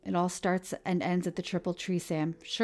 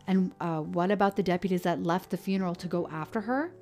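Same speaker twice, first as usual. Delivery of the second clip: interrupting; 3 semitones higher, 6 dB louder than usual. Acted. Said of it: "Kyle interrupted".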